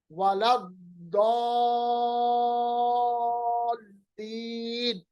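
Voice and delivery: वलद्दीन chanted with the द held very long; lengthened dad. Shown as a demonstration. Teacher demonstrating the long dad sound in recitation. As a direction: stretch the दाद sound in वलद्दीन. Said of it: "आप देखिए कैसे मैं अदा कर रहा हूँ".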